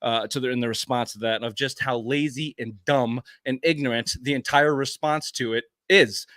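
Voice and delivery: ordinary talk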